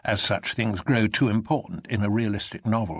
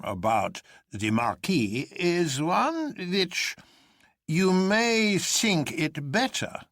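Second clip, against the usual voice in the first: much higher, slow, drawn-out words like an anthem; professor dragging words out; 8 semitones higher, slower than usual. A narrator's reading. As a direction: stretch every word as if chanting an anthem. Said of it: "dragging out like words in an anthem".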